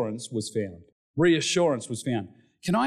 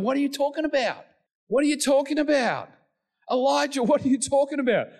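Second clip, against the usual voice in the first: high, insistent acted scolding; exasperated rebuke; 13 semitones higher, faster than usual. Preacher voicing God answering Elijah's self-pity, exasperated rebuke. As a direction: scold him in high-pitched disbelief.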